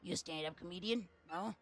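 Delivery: lightly voiced mob boss; Don Pianta voice. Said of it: "I'm Don Pianta".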